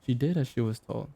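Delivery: usual speaking voice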